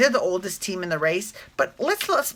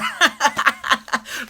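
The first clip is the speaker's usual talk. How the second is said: laugh; 10 semitones higher, 5 dB louder than usual. Real, not acted. Laughing at small world coincidence.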